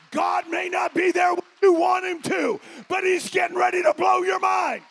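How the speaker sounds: loud, high, shouting preacher's cry; full-force preaching. Real, not acted. Pastor shouting to the congregation, full-force preaching. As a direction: preach it out at the top of your voice.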